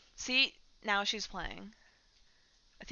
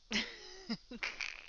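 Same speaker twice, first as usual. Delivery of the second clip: high-pitched giggle; short burst of high, soft laughter; 12 semitones higher, 6 dB quieter than usual. Real, not acted. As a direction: giggle while teasing a pet. Laughing while playing with cat.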